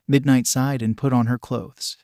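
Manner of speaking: plain reading voice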